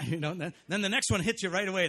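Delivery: ordinary talk, nothing marked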